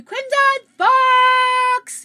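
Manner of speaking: high, loud, drawn-out call; excited greeting. Real, not acted. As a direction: hail a friend across the room. Calling out a viewer greeting.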